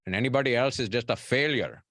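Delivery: a hint of a strict immigrant father; mimicking his dad. Son imitating his physician father's career rule, lightly voiced. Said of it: "that's, like, my dad".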